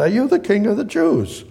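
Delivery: high-pitched questioning Pilate; incredulous Pilate. Acted. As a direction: ask it high, in comic disbelief. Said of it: "He said".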